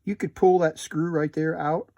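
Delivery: usual speaking voice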